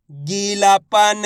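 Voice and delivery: गीलापन very slow, every syllable held; syllables blended slowly. Shown as a demonstration. Teacher joining letters for learners, गीलापन drawn out. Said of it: "उच्चारण करके इनको पढ़ रहा हूँ".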